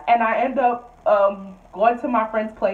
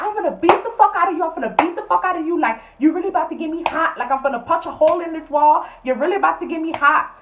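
high, fast angry threat; mock angry roommate threat; 6 semitones higher, faster than usual. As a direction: imitate her yelling threats, higher and fast. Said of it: "she's like".

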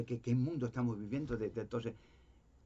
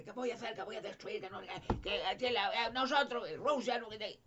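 high squawking mock threat; a squawking parrot version of a Soviet leader; mock Khrushchev parrot; 9 semitones higher than usual. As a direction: parrot the dictator's bluster, high and silly. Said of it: "papagayo de".

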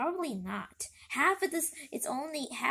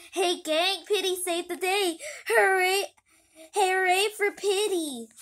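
high, loud cheering crowd; 7 semitones higher, 9 dB louder than usual. Acted. Gang cheers Pity for saving the day, loud and high. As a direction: cheer like kids greeting a hero.

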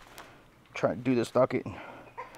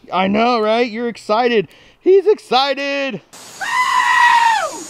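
excited; 11 semitones higher, 6 dB louder, slower than usual. Real, not acted. High excited voice to goat.

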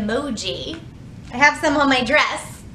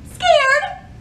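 much higher and louder, hammed-up fright; mock scared; 6 dB louder than usual. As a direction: squeal the word in pretend fear. Revealing scared emoji face.

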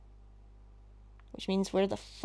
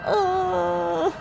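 high screamed panic breaking into sobbing laughter; scream, then dissolve into wailing hysterics; real hysterics; 10 semitones higher, much slower than usual. Real, not acted. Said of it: "okay, hysteric's over".

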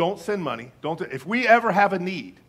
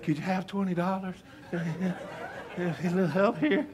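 softer, laughing mock plea; mock pleading beggar; 2 semitones higher, 4 dB quieter, slower than usual. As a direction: beg sheepishly while chuckling. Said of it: "I emailed my own wife and said".

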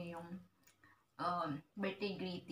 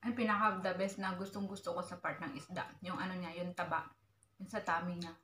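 speaking between bites; chewing while talking; 3 dB louder, much faster than usual. Real, not acted. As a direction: food in mouth. Seen 1: Holds fish piece, seems to chew between phrases, bites at 4.9.

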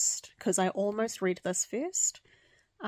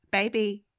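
raised voice, calling the cat; calling a pet; 6 dB louder than usual. Real, not acted. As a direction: call out to get the cat's attention. Calling her cat who ignores her.

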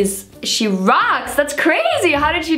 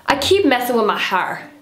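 usual speaking voice

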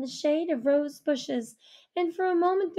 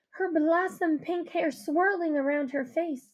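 ordinary delivery, nothing marked

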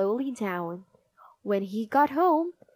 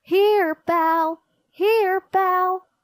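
loud, high call for a lost dog; 9 semitones higher, 8 dB louder, much slower than usual. A narrator's reading. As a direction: call the puppy from afar. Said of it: "He began calling".

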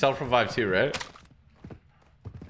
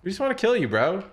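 mocking echo; 7 semitones higher than usual. Mocking the enemy's line back.

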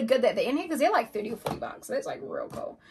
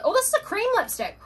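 surprised squeal; 12 semitones higher, 4 dB louder than usual. Real, not acted. Surprised discovery.

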